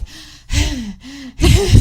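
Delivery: loud, heavy panting breaths; exaggerated huffing for air; mock panting. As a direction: imitate being out of breath after a fight. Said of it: "like, staring at each other, panting".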